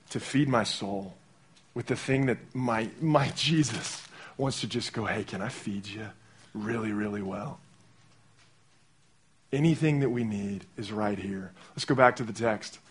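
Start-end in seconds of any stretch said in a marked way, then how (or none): 5.0-7.6 much softer, hushed and tender; gentle invitation. Retold or imitated. Jesus gently inviting a tired believer to eat, softly. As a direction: coax kindly, like offering a meal. Jesus voiced offering to feed.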